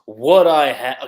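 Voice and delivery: slow, loud shadow-creature voice. Acted. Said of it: "like, shadow voice".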